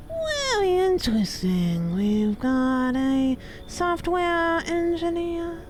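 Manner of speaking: high, very slow mock-impressed drawl; sarcastic. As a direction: fake being wowed. Mocking the stranger's job.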